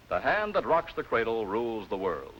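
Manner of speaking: normal talking voice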